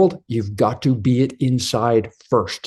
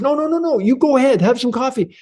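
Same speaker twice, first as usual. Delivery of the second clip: much higher, helpless flustered voice; panicky stuck man; 13 semitones higher, faster than usual. Retold or imitated. Man failing to pour coffee in front of his friend, panicking in a much higher voice. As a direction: squeak out the helplessness of a man who can't pour.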